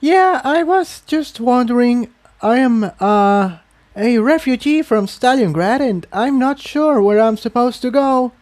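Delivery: stumbling, unsure. Acted. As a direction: trip over the words, nervous newcomer. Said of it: "stumbling over her words".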